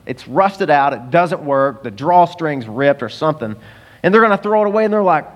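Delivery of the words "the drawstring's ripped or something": ordinary delivery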